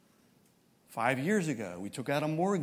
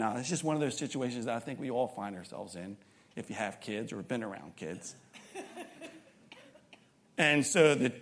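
ordinary delivery

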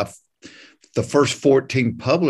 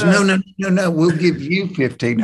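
raised, louder mock-insistent correction; quick run of higher no-nos, retold; hasty reassurance; 5 semitones higher, 4 dB louder than usual. Publisher correcting the nervous author about who pays, retold hasty reassurance.

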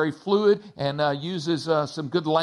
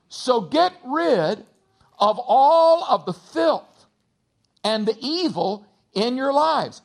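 higher, measured reading of scripture; 5 semitones higher, 4 dB louder, slower than usual. Acted. Pastor reading James to the church, measured and solemn. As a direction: read the verses with weight.

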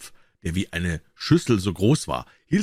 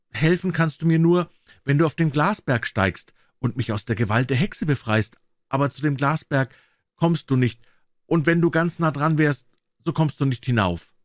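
higher maiden's voice, earnest; enchanted princess, warning; 7 semitones higher than usual. A narrator's reading. Princess warns drummer about the glass mountain, high and earnest. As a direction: warn him in a raised, earnest pitch.

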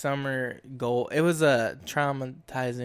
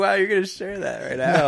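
shocked reaction; 6 semitones higher, 7 dB louder than usual. Retold or imitated. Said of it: "I was like".

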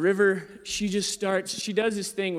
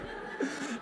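voiceless wheezing laugh, much softer; breathy laugh; 8 semitones higher, 10 dB quieter than usual. Real, not acted. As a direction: let out an airless laugh.

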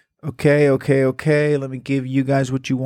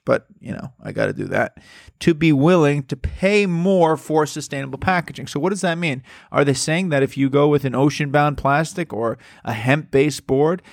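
ordinary delivery

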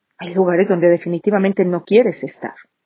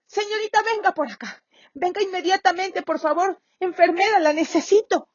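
high, softer urgent pleading; pleading call; 10 semitones higher, 5 dB quieter than usual. Acted. Patient still begging the nurse to come, high and softer. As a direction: keep calling her, voice up but dropping off. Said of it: "oye un grito enorme".

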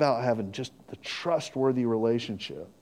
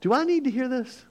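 stopping gossip; 9 semitones higher than usual. Shown as a demonstration. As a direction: halt the gossiper with raised-pitch disbelief. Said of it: "and then you do this".

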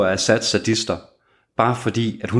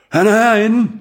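much higher and louder, a full yell; shouting out; 13 semitones higher, 6 dB louder than usual. Acted. Prospect in the doorway calls to his gang: a loud yell. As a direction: bellow it across the shop to alert the gang. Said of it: "står i vindfangen og råber".

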